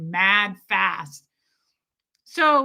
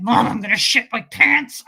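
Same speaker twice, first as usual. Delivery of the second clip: louder desperate plea; urgent plea; 8 dB louder than usual. Retold or imitated. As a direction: beg with urgency.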